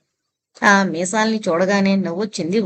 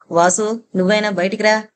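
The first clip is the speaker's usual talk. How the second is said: usual speaking voice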